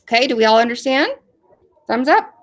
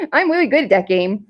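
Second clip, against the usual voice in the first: put-on giggly childlike puppet voice; proud, teasing little puppy character; 4 semitones higher than usual. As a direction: burst out playfully, then giggle. Voices Puppy teasing, then laughing.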